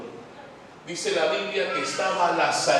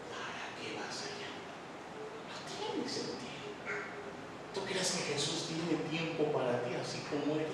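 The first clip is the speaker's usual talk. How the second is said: whispered, doubting friend; a sneering whispered voice of naysayers; hushed discouraging whisper; 2 semitones lower, 9 dB quieter than usual. Retold or imitated. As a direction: hiss doubt into his ear.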